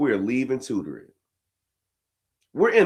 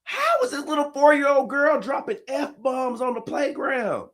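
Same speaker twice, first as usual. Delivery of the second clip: high, cracking disbelief; high shocked cry; 13 semitones higher than usual. Retold or imitated. Dad asking about the four-year-old cursing, high and cracking. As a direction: shriek the question in amazement. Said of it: "I said".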